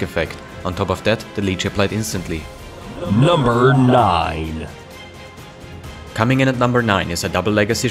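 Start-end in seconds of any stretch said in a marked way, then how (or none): 3.2-4.7 slow, dramatic announcer voice; drawn-out countdown announcer call; top ten countdown. Acted. Top ten list countdown card.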